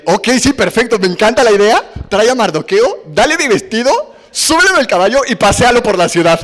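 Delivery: the king happily springing his plan; eager king. Retold or imitated. The king answering Amán, cheerfully excited. King gladly orders Mardoqueo honored.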